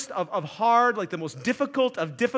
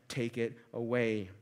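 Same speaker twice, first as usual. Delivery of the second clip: "Take it away" much lower and softer, falling; hushed plea; 10 semitones lower, 7 dB quieter than usual. Shown as a demonstration. Preacher quoting the same plea, hushed. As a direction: breathe "Take it away" low and quiet. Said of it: "go beyond just asking God".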